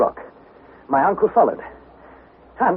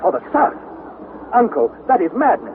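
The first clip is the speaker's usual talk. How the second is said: alarmed disbelief; 8 semitones higher than usual. Acted. Nephew alarmed at uncle's order.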